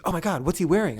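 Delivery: imitated burst of excitement; excited outburst. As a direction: act out a thrilled paparazzo. Acted excited paparazzo.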